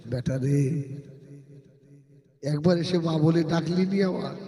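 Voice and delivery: a hint of an old mother pleading softly; lightly voiced lonely mother calling her son. Old mother longing for her son, a light hint of pleading. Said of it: "মা ... চুপ করে দেখে".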